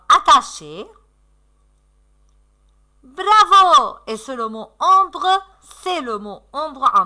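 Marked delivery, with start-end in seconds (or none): 3.0-4.0 loud, high bravo; enthusiastic praise. Real, not acted. Teacher cheering a correct answer, enthusiastic. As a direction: cheer the pupils on loudly.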